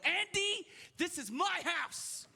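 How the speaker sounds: high, drawn-out trash talk; mock challenge. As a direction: take on the kid with exaggerated, slow emphasis. Drunk dad challenges kid on headset.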